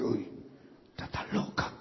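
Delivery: very soft, voiceless mutter; whispered aside. Retold or imitated. Husband privately judging his wailing wife, whispering. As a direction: whisper it to yourself.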